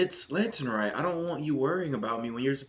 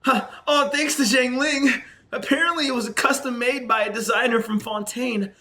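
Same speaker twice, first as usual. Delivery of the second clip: put-on high girl voice; man doing a woman's voice; 8 semitones higher, 4 dB louder than usual. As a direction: read the girl's lines up high. Playing Hu Tao.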